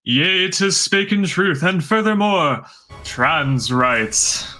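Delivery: solemn archaic prophet proclamation; Old Testament prophet voice. Acted. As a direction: declaim it like a biblical prophet. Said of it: "I got to pull out my Old Testament prophet voice".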